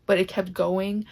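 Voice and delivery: ordinary talk, nothing marked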